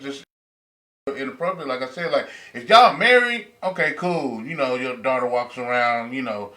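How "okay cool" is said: ordinary talk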